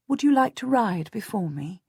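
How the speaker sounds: ordinary delivery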